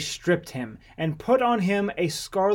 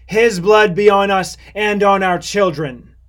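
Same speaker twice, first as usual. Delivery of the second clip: loud, raised, solemn vow; ringing, higher-pitched declaration; crowd's loud answer; 4 semitones higher, 10 dB louder than usual. A narrator's reading. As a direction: declare it boldly as a whole people. Whole crowd answers Pilate.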